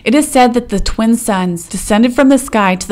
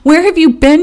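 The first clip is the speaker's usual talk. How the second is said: excited; 8 semitones higher, 4 dB louder than usual. Acted. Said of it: "Changing Woman rejoiced".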